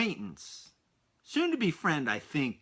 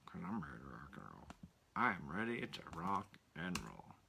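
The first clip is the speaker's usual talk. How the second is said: deep murmured hype; 9 semitones lower, 14 dB quieter than usual. Shown as a demonstration. Much deeper quiet self-hype.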